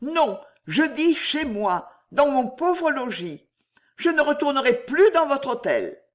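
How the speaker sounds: voix de femme haut perchée, catégorique; Juliette résolue. Acted. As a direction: refuse net, le ton monté. Narratrice jouant Juliette. (personnage féminin bouleversé mais décidé, voix haute)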